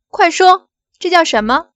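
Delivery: shouted high, impatient order; loud demand. Acted. Boss demanding an answer.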